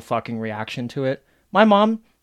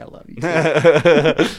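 slow, loud mock-tender reply; 5 semitones higher, 7 dB louder, much slower than usual.

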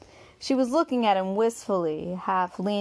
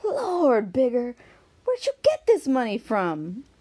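high, louder amazed gasp of a question; 6 semitones higher, 4 dB louder than usual. A narrator's reading. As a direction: she can't believe the money she's counting. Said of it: "change to one of amazement".

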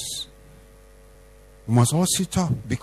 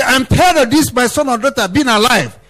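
much higher and louder, preacher voicing God; loud, high-pitched divine pronouncement; God's declaration; 11 semitones higher, 7 dB louder than usual. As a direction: cry it out as God's judgement. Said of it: "The day God will say".

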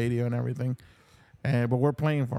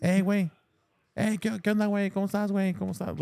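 casual friendly greeting; 6 semitones higher, faster than usual. Shown as a demonstration. Explaining the word güey to the band, friendly demo. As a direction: demo of a breezy Spanish greeting, higher. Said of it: "Like that".

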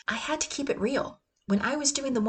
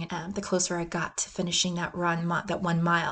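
ordinary talk

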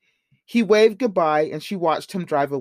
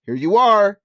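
loud, higher, cheery announcement; upbeat and louder, arriving with a passenger; cheerful; 5 semitones higher, 6 dB louder than usual. A narrator's reading. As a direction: call it out cheerfully. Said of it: "he said cheerfully".

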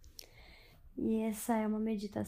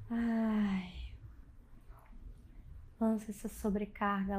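guided meditation; 5 dB quieter than usual. Acted. Leads a bedtime meditation.

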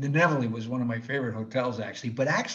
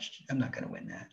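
whispered aside; 11 dB quieter, faster than usual. Retold or imitated. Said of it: "muttered aside to himself".

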